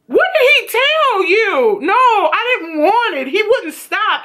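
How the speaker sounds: high, slow, laughing mock distress; distraught Jules. Imitating Jules telling her friend, high mock distress. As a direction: act out her panic half laughing. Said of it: "Jules is like".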